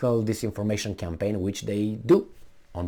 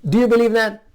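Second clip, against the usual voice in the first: incredulous; 11 semitones higher, 8 dB louder, faster than usual. Real, not acted. Incredulous outburst.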